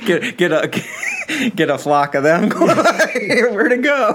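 giggling through the words; laughing. Real, not acted. Cohost joking about a zebra herd, laughing. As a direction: laugh while you say it.

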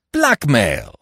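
loud, high, sharp exclamation. A narrator's reading. Stoner correcting the magistrate, sharp. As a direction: fire the word out sharply. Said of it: "said Stoner sharply".

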